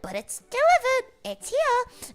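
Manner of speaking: high, chirpy put-on notification voice; high mock delivery notice; mock Amazon voice. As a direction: chirp it like a cheerful app. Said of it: "Amazon is like".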